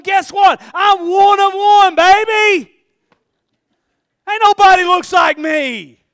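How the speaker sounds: high, full-voiced boastful holler; loud, swaggering preacher yell; triumphant shout. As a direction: belt it out proudly, stretching the words. Models a proud self-declaration to congregation.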